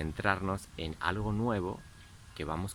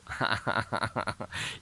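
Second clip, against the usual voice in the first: short laugh; 4 semitones higher than usual. Real, not acted. Laughs after the gibberish.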